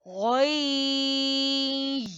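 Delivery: गै drawn out high; syllable demo. Shown as a demonstration. Teacher showing students the merge, sung out. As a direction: sustain गै, noon merged away. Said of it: "कैसे?".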